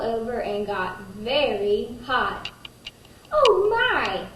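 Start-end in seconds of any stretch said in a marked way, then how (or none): 3.3-4.3 high, louder "oh my" of alarm; startled old woman; surprised cry. A narrator's reading. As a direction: gasp it out as the old woman smells burning. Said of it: "said the little old woman".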